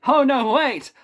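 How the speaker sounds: ordinary talk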